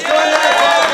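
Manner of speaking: high, loud call to the crowd; loud stage call to bring Seth up. Real, not acted. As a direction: beckon him up from across the room. Calling man up on stage.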